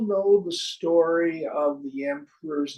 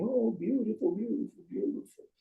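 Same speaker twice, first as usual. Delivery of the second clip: high, fast mock gushing over the cloth; fake gushing praise; 8 semitones higher, faster than usual. Courtier to the charlatans, mock gushing praise. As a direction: gush like a flatterer lying. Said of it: "people lie and they exclaim".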